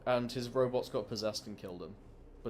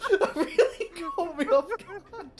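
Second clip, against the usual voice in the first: high, loud and laughing; laughing hard; 13 dB louder, slower than usual. Real, not acted. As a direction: crack up while talking.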